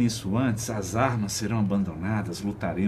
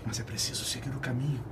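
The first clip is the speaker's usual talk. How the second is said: whispering; 4 semitones higher, 6 dB quieter, faster than usual. Acted. Burning, struggling in fall.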